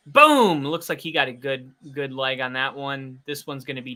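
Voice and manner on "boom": excited shout. Real, not acted. Reacting to a big punt.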